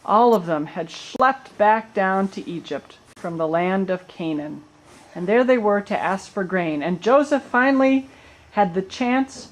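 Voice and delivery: ordinary delivery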